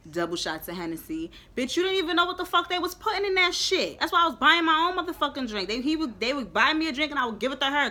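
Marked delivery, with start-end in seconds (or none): 1.6-5.7 high, loud scolding of a friend; exasperated retold shout; retold yelling. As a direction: tell her off all over again, high and loud.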